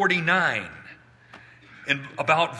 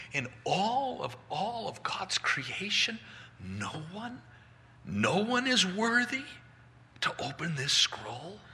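soft, halting question, lifting higher; disbelieving wonder; 5 semitones higher, 6 dB quieter than usual. Acted. John realising nobody can open the scroll, soft dismayed wondering. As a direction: wonder it softly, in mild dismay. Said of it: "And he wonders".

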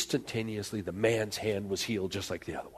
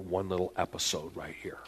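hushed whisper; 4 semitones lower, 6 dB quieter than usual. Real, not acted. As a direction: lean in and whisper it. Preacher drops to a whisper.

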